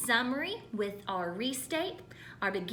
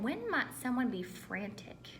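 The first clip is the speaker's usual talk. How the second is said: whispered prompt; 5 dB quieter than usual. Real, not acted. Teacher whispers a thinking prompt to students: soft whisper. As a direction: whisper the question to the class. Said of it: "Think in your head".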